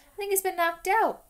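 worried shout over the rat; boy panicking over his pet; alarmed. Acted. Ron alarmed over Scabbers.

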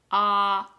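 "Ah" held open and loud; short O sound. Shown as a demonstration. Teacher models for pupils, short O as a loud "Ah". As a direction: hold Ah as the short O vowel. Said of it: "Short sound".

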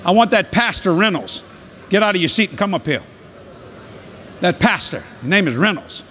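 urgent ministry voice, projected to the room; preacher's altar call. Real, not acted. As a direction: summon them forward with authority. Calls people forward during prayer.

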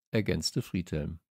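ordinary delivery

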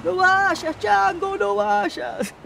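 high, loud imitation of a desperate plea; mock pleading cry. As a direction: call after someone in hammed-up desperation. Acting out a film scene's plea.

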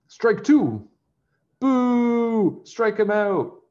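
high, loud ballpark shout; loud umpire call and jeer. A narrator's reading. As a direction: call it out and boo hard. Umpire call and crowd jeering.

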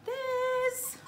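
high excited coo. Real, not acted. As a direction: ask with a gasp of delight. Excited reveal of toy.

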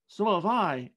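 put-on high child's exclamation; exclaiming. A narrator's reading. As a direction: chime in eagerly. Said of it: "exclaimed another".

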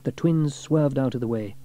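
ordinary delivery

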